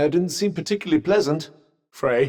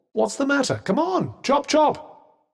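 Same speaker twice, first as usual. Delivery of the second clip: impatient urging; 10 semitones higher than usual. Acted. Urging a reluctant companion to jump.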